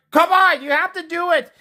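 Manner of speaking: high-pitched urging teacher. Retold or imitated. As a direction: imitate a teacher pleading. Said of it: "The teacher's like".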